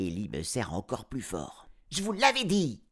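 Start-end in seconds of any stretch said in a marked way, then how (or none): 1.9-2.8 high, triumphant shout; girl shouting at the vet. A narrator's reading. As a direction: throw it in his face. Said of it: "crie-t-elle à Arif".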